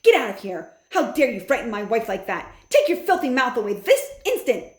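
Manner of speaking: loud furious orders; father towering over them, shouting. A narrator's reading. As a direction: roar them out of the room.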